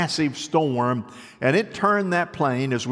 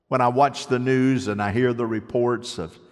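ordinary delivery

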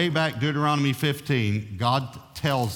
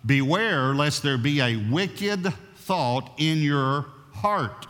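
solemn scripture reading; 3 semitones higher, much slower than usual. Acted.

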